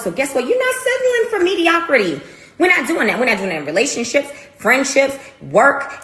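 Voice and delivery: rising, emphatic push; rally them, voice climbing high; fired up. Real, not acted. Fired-up motivational rant.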